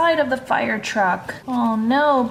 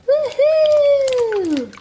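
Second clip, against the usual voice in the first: very high gleeful whoop; excited whoop; 12 semitones higher, 5 dB louder than usual. Acted. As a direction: whoop it out like a thrilled kid. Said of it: "you're super excited for bath time".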